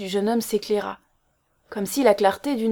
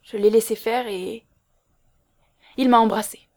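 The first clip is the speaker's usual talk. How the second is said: halting, then louder confession; hurried blurting; 4 dB louder than usual. A narrator's reading. Girl admitting the kiss, blurting. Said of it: "puis lança précipitamment".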